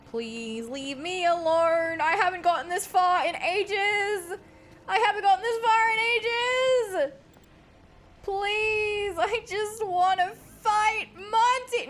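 high, drawn-out hammed-up whining; wailing plea. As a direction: build from a slow plea to a loud wail. Pleading with the game.

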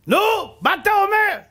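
very high, loud taunt; loud, high mocking challenge; challenging driver. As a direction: fire the question back at him. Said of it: "driver challenges him".

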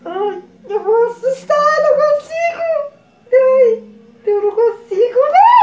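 high, trembling whimper, real fear; frightened whimper. Real, not acted. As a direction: whine it out, too scared to look. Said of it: "eu tremo". (choramingo de medo, agudo)

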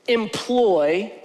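ordinary talk, nothing marked

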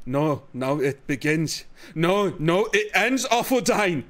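slow, low mock Scottish growl; mock Scottish accent. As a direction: play a gruff Scottish knight, drawn out. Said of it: "İskoç aksanı yapmış".

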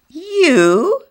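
shrill loud disbelief; indignant high yelp. A narrator's reading. Other house scoffing at Missus Millefleur, loud and high. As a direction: scoff at the very idea.

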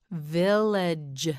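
"Village" stretched slowly over both syllables; drawn out. Shown as a demonstration. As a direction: linger on each sound of "Village". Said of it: "you say it".

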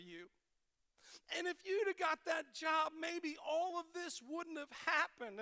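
a touch of a friend's emphatic insight; earnest revelation. Retold or imitated. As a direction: urge him with growing intensity. Will Muff's earnest revelation.

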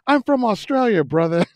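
high-pitched mock Australian accent. Comedian friend announcing where he's from, mock Australian accent. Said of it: "that's his claim to fame".

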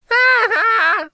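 high, loud ha-ha; loud, high prankster's laugh; boy's mocking laugh. A narrator's reading. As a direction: laugh at them in a high boyish voice. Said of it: "The boy laughed".

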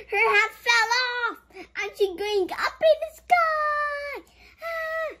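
rising excitement; excited. Real, not acted. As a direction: gush about the flying hat. Excited at kite picture.

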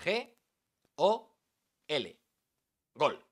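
spaced letters rising then landing on gol; spelled out G O L. Shown as a demonstration. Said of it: "volcar toda su pasión en tan solo tres letras".

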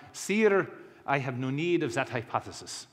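put-on French accent. Laplace answering Napoleon about God, French accent. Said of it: "That's my French accent".

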